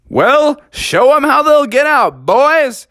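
high, loud showman call; host voice. Acted. The cave voice calls on the bats, high and loud. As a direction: command the bats like a ringmaster.

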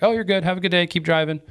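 lightly imitated cheerful landowner; mock-friendly landowners. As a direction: wave them on sweetly, all is forgiven. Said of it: "They're like".

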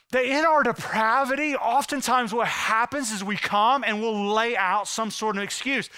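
ordinary talk

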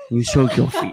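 loud put-on voice at normal pitch; mock command. Host ordering co-host to soak feet, loud mock command. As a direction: boom it out as a jokey order. Same joke, now loud and low.

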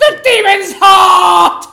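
loud, slow, high shout; sudden shout. Acted. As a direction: bellow it suddenly. Sudden outburst at show.

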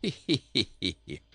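merry acted giggling laugh; king's laugh. A narrator's reading. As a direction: burst out laughing as the king. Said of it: "laughed the king".

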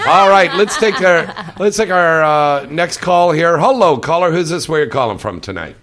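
big on-air host voice; radio host announcer. Acted. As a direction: boom it out like a late-night call-in host. Host takes next radio call.

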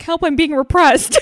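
high, loud hammed-up wail; mock cry for help. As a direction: yell for help while half laughing. Shaken peasant cries for help.

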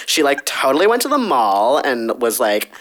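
put-on airy mall-girl Valley Girl chatter; totally Valley Girl, like at the mall; Valley Girl accent. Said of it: "Valley Girl accent".

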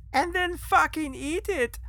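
high, loud, slow outburst; wild interruption. Acted. Excited man interrupts his boss's speech, high loud outburst. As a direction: butt in like a gleeful lunatic. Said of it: "Francis interrupted".

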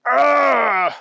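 high groaning cry, falling; pained yell. A narrator's reading. Bunny getting up on broken paws, high pained yell. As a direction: let out an agonized "arrgh". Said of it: "he yelled".

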